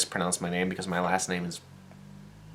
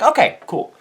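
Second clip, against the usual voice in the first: much higher, loud okay cool; squeaky relief; 11 dB louder than usual. Retold or imitated. To the cop about the ticket, squeaky relieved. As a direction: chirp it out, relieved.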